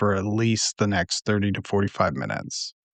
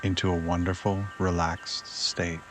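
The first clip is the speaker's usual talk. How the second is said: unhurried, settling into softer, lower phrases; guided meditation; 3 semitones lower, 6 dB quieter than usual. Acted.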